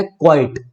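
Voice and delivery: क्वाइट as "kwite", one syllable; one-syllable pronunciation. Shown as a demonstration. Teacher introduces the other word, one-syllable quite. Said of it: "अब ये वाला जो है ना".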